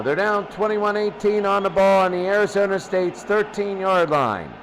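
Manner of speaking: usual speaking voice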